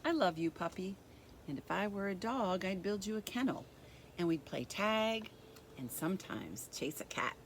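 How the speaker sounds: gentle, even storybook reading; reading to children. A narrator's reading. Teacher reading a picture book to kids, gentle storybook voice.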